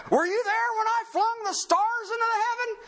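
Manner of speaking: high-pitched, ringing sarcastic challenge; God being sarcastic. Acted. Creator challenging Job about the stars, loftily sarcastic. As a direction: throw down a mock question from on high. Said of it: "That's sarcasm".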